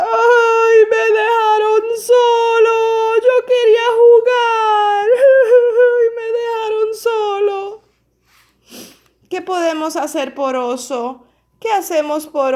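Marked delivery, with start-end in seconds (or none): 0.0-7.8 high, loud blubbering bear voice; crying bear. Acted. Teacher voicing lonely bear crying to children. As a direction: wail it like a lonely sobbing cub. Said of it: "Oso llora y balbucea".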